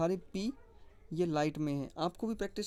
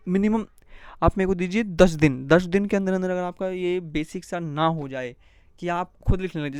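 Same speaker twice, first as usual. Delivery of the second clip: enthusiastic pitch; 2 semitones higher, 11 dB louder than usual. Real, not acted. Teacher promising results enthusiastically.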